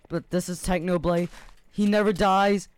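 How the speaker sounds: loud, rising boast; hyped-up bragging; boastful hype. Real, not acted. Gamer bragging that Technoblade never dies, loud.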